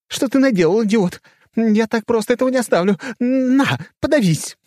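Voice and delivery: very high, loud furious screaming; hysterical screaming. A narrator's reading. Goddess rages on.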